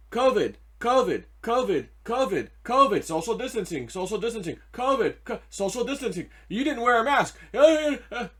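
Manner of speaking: mock a nagging, scolding alarm; mock media hectoring. Mocks the media's hammering of covid messaging.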